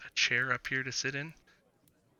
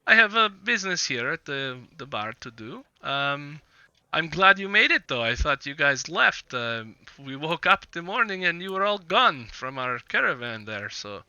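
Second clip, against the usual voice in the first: lilting character voice, often higher; Vistani man declining politely; 5 semitones higher, 5 dB louder than usual. Acted. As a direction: politely beg off, pitch rising and swinging. Game master voices Vistani man.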